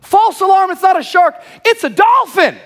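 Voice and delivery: very high and loud relieved call, laugh at the end; Pedro calling out. Retold or imitated. Pedro telling the panicked friends it's a dolphin, high loud call. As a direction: shout the good news across the water. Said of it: "our friend Pedro goes".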